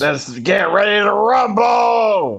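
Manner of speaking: high, drawn-out announcer call; ring announcer shout. Acted. Co-host kicking off the wrestling show, ring announcer shout. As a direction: stretch it out like a fight announcer.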